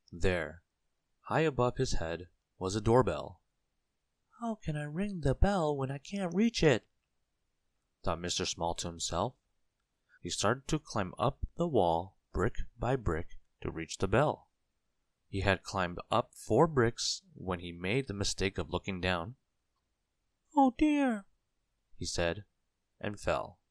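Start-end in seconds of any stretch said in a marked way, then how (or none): 4.4-6.9 high, small character voice; tiny Mister Small. A narrator's reading. Tiny man wondering how to reach the bell, high voice. 20.6-21.3 high, small character voice; tiny Mister Small. A narrator's reading. Tiny man wondering how to reach the bell, high voice.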